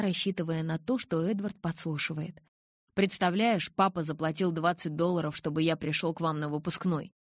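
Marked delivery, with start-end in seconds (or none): none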